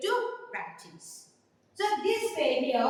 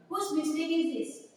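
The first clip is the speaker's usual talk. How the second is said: ordinary delivery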